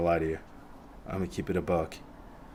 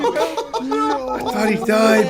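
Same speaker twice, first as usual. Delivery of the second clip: laughing; 14 semitones higher, 4 dB louder, much slower than usual. Real, not acted. Relief after the close fight.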